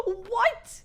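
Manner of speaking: high-pitched, affronted squawk; mock offended shock. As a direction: imitate an insulted, startled reaction. Acts offended reaction to crying question.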